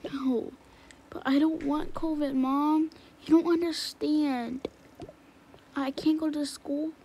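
upset protest. Acted. Child protesting to mom about covid, whiny and upset. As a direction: plead against the news, rising. Kid hears family has covid.

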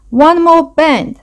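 high, drawn-out eager call. A narrator's reading. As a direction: announce it with keen anticipation. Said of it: "said Vole eagerly".

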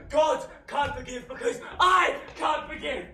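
loud, high shout with a laugh in it; bitter laughing shout. Acted. Faustus to friends, shouting bitterly about forgiveness.

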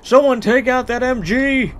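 high, loud call to the squad; battlefield shout. Pretend. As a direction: yell an order under fire. Soldier calling out in war story.

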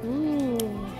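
ordinary talk, nothing marked

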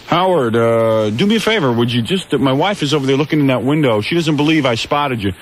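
high-pitched imitation of a pestering stranger; pushy fan. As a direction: mimic some jerk asking a favor. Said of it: "comes up to me and goes".

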